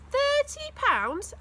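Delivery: high, slow disbelieving rise; can't believe the offer; astonished. A narrator's reading. Boy amazed at mum's offer, high and slow. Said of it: "I was so astonished".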